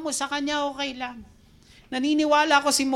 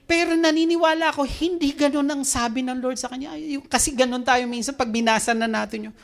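ordinary delivery

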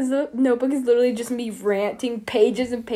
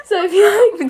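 laughing; 9 semitones higher, 10 dB louder than usual. Real, not acted. Laughing at own idea.

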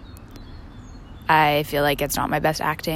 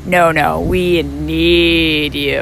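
loud, very slow insistence; hammed-up grandparents refusing, louder and drawn out; insistent no; 9 dB louder, much slower than usual. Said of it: "they're like".

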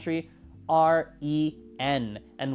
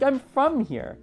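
much higher, emphatic mock protest; insistent; 10 semitones higher, slower than usual. The friend insists to locals that she's from Lima, high mock indignation. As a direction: insist like it's obvious. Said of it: "She's like".

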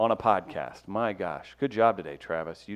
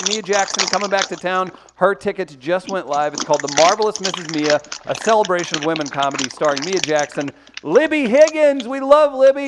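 ad read; 6 semitones higher, 4 dB louder than usual. Acted. Promo read.

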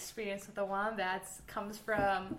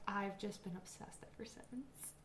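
whispering; 2 semitones lower, 15 dB quieter than usual. Real, not acted. Trails into whisper.

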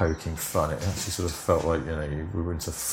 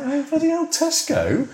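shrill disbelieving cry, much higher; retold shock at the chip packet; astonished; 3 dB louder than usual. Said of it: "And I was like".

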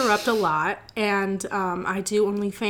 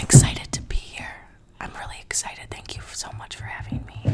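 ASMR whisper. Pretend. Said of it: "You're pretty good at this A S M R".

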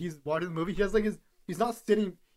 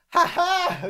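high, loud mock cackle; Tom Cruise meme laugh; 13 semitones higher, 6 dB louder than usual. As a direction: burst out in a manic meme laugh. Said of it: "You know that meme of him laughing".

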